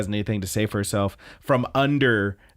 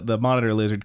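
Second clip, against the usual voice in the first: ordinary delivery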